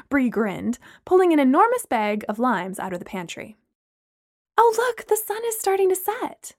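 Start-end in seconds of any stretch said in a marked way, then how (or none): 4.6-6.5 delighted friend. A narrator's reading. Amanda voiced gushing at sunset.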